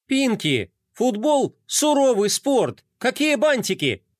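high, outraged protest; indignant. Acted. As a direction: protest in disbelief. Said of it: "возмутилась скейтбордистка".

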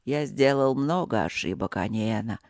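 low, gentle rumbling voice; soft rumble. Acted. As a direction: rumble it softly to her. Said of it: "пророкотал владыка мягко".

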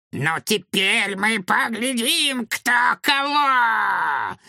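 high, slow, gleeful villain shout; ecstatic roar. Acted. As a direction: roar it out in triumph. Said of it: "восторженно взревел он".

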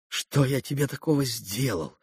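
soft, moved, gentle question; softened, affectionate voice; tender smile. A narrator's reading. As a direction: answer gently, deeply touched. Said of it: "умилённо улыбаясь".

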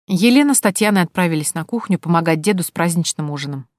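ordinary delivery

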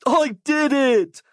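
high, drawn-out victory cry; triumphant cry. A narrator's reading. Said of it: "Mike cried".

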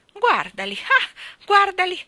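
high, loud jeering bird voice; shrill-free taunting starling; mocking starling. A narrator's reading. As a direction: jeer at the children like a cheeky bird. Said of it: "li canzonava lo storno".

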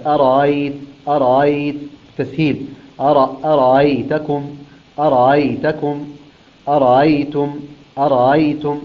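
أرأيت with the second hamza softened; eased hamza. Shown as a demonstration. Teacher demonstrating Qalun's easing. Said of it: "يقرأوها هكذا".

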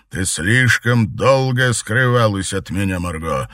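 harsh. A narrator's reading. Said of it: "жёстко проговорил он".

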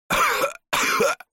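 rough hoarse coughing fit; heavy cough. Acted. Hoarse cough after awakening.

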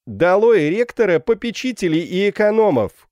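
high, loud rallying cry; shouting. A narrator's reading. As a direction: shout it like a protest slogan. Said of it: "крикнул Жан". (выкрикнуть лозунг)

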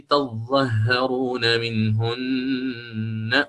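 slow chanted recitation, ya held long; تلاوة مع إشباع المد; Quranic recitation with full madd. Shown as a demonstration. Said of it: "فيقرأ هكذا".

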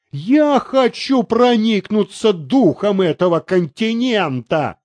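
ordinary delivery, nothing marked

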